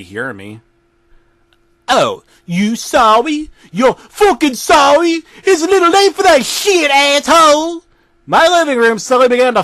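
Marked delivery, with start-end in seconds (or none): 1.9-7.8 high, loud mocking fury; mocking angry yell. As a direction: throw his apology back at him, yelling. Jack yelling at narrator.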